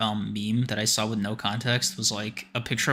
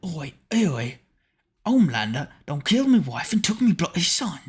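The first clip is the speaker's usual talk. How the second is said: higher, louder Cockney Butcher voice, roaring; Butcher impression; 6 semitones higher, 3 dB louder than usual. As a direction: bellow at Homelander like a furious Cockney. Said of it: "are you Butcher".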